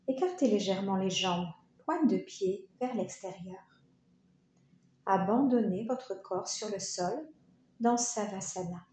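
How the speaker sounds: normal talking voice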